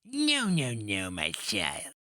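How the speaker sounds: mock him from far above in a crone's voice; shrill old witch sneering at a captive; rat witch voice. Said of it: "she said".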